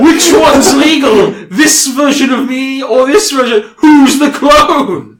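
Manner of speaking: high, loud, slow theatrical taunt; taunting villain. Acted. Clone confuses the referee mid-match, taunting theatrically. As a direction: crow it, stretching the last word.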